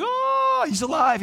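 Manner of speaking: shocked that he's really alive; amazed gasp. Acted. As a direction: church stunned at the door, amazed cry. Church shocked at Peter.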